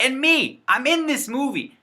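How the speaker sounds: high-pitched, thrilled announcement; excited boast. Real, not acted. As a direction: proudly show off, voice jumping up. Proudly announces his own movie role.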